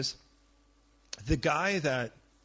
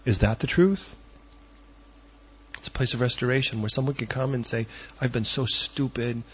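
louder, driving sermon delivery; a pastor preaching loud to the congregation; full-force preaching; 3 semitones lower, 5 dB louder than usual. Acted.

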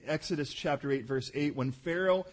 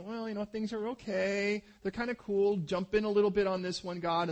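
casual prayer; 6 semitones higher, 4 dB louder than usual. Retold or imitated. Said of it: "not just going".

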